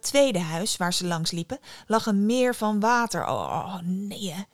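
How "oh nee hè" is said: dismayed exclamation. A narrator's reading. Hanged man sees the house surrounded by water, dismayed groan. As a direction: groan it out, put off. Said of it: "riep de gehangene uit".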